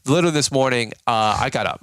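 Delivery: ordinary talk, nothing marked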